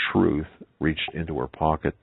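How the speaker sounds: usual speaking voice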